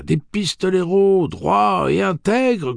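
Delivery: high and loud, drawn out; crowd cry. Acted. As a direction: hail them with fervour. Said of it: "cria une voix".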